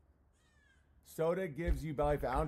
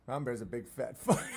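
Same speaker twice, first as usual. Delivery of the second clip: laughing; 3 dB louder than usual. Real, not acted. Laughing through the joke.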